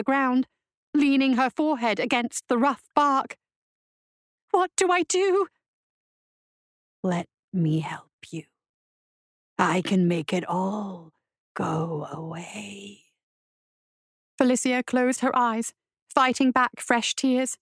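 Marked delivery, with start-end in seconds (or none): 4.5-5.5 high-pitched, helpless question; distressed plea. A narrator's reading. Woman at a loss begs the voice, high distressed plea. 7.0-13.1 soft, slow, whispery coaxing; purring demon voice. A narrator's reading. Voice offers to take her pain away, slow purr. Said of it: "the voice purred".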